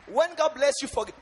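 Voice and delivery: high, loud preacher cry; preaching shout. Real, not acted. Peak of repeated sermon call.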